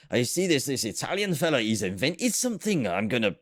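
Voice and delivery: high-pitched mock Scottish accent; hammed-up Scottish impression with swooping pitch. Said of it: "this is Scottish accent".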